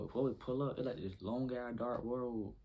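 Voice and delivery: ordinary talk, nothing marked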